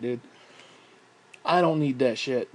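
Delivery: ordinary talk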